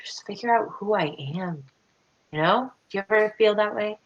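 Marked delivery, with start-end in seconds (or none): none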